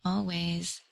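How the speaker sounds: soft, absent repeat of dictation; quiet, spaced-out read-back; writing it down. Real, not acted. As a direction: murmur the words as you note them. Repeating card message while noting it.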